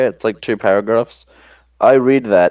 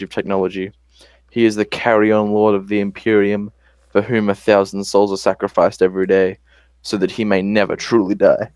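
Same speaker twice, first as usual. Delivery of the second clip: grave, measured Australian narrator voice; dramatic Australian accent. Acted. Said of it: "read it in my dramatic Australian voice".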